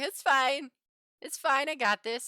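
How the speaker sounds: high, loud acted insistence that all is well; playfully over-insistent, retold reassurance; breezy overconfident reassurance. Retold reassuring of panicking lab partners.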